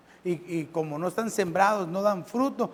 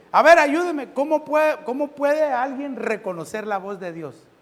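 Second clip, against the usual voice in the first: calling on the crowd; 6 semitones higher, 6 dB louder than usual. Real, not acted. Asks the congregation.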